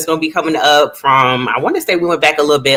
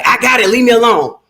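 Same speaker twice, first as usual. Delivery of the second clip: gruff tough guy; 9 semitones higher, 3 dB louder than usual. Retold or imitated. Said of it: "Incredible Hulk of like".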